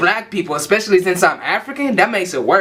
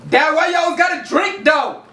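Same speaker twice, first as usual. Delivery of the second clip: shouting; 10 semitones higher than usual. Acted. Kid yells at dad.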